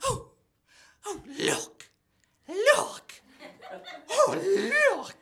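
high, put-on old lady voice; old grandma voice. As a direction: play the nosy grandma spotting someone. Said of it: "she'd be like".

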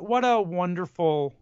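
ordinary delivery

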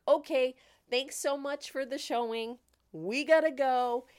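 playfully retold urgent goodbye; hasty exit excuse. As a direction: blurt thanks to get out of there. Retold hurried excuse to leave showing.

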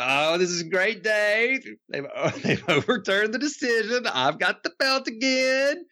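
high, gleeful mock Bryan, slowed; gloating Bryan. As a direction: crow over the belt in a high put-on voice. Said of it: "he's like".

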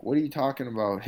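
mock-baffled retelling of a reaction; retold disbelief. Fighter retelling his reply to coach's surprise news, incredulous.

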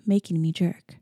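ordinary delivery, nothing marked

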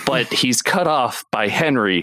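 ordinary talk, nothing marked

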